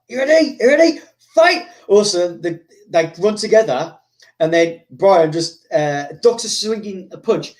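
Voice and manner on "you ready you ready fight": high, loud mock referee call. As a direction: start the bout like a referee, rising to the last word. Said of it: "the referee's, like".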